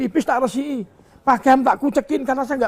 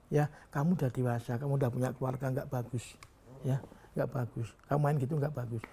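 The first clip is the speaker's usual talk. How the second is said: much softer, lower admonishing voice; soft, low father gently warning his grown son; quiet fatherly warning; 8 semitones lower, 10 dB quieter than usual. Retold or imitated. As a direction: hush it down like a stern private word to a son.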